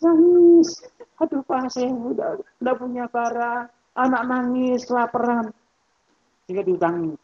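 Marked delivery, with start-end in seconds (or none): 0.0-0.8 pitched way up and louder, a hammed-up wail; mock wailing. Preacher mimics a debtor coming in crying, mock wail. 1.2-5.5 higher and softer, a mock pitiful plea; pleading debtor. Debtor begs the shopkeeper for more credit, mock pitiful plea.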